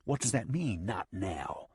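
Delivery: plain reading voice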